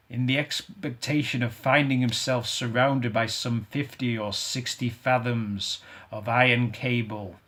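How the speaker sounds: usual speaking voice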